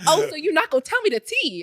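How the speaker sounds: high, loud, nosy disbelief; playfully scandalized at being left out; retold sassy protest; mock affronted.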